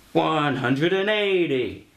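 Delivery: high, stretched-out darts call; hammed-up high yell, drawn right out; mock darts scream. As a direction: call it out slowly like a darts announcer. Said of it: "And he screams".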